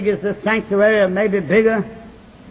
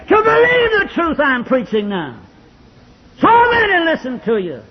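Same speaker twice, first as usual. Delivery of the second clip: preaching at full force; 7 semitones higher than usual. Acted. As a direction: build to a thundering revival climax. Preaching climax, rising and repeated.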